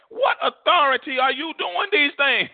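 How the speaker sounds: high-pitched mock demand; indignant challenge. Preacher voicing chief priests confronting Jesus, indignant.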